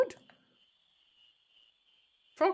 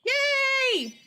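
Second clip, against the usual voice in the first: high, loud cartoon cheer; dopey cartoon bellow; 6 dB louder than usual. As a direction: squeal it out with glee. Quina cheering over the frog.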